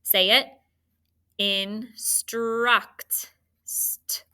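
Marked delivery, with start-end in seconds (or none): 1.4-4.2 "Instruct" slowly split into in and struct; sounded out. Shown as a demonstration. Teacher helping students spell, "Instruct" sounded out piece by piece. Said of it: "so scoop those syllables".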